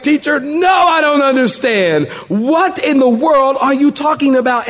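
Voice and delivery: high-pitched exasperated confusion; baffled pupil. Acted. Confused pupil challenges the teacher, high baffled outburst. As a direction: blurt out that you are lost, pitched high. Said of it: "I'm like".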